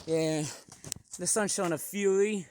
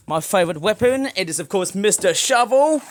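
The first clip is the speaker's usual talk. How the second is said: big hyped reveal, louder; loud announcement; 10 dB louder than usual. Real, not acted. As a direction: announce the weapon like a showman. Announcing favourite weapon loudly.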